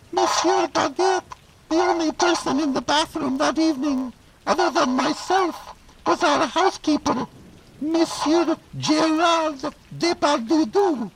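very high squeaky femme fatale; falsetto lady voice. Acted.

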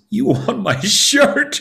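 high, slow, laughing through the words; laughing disbelief. Acted. Beggar laughs when king asks for his shirt, high and slow. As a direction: laugh at the absurd request. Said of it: "he starts to laugh".

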